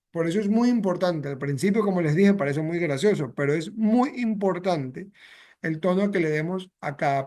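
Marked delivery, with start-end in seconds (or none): none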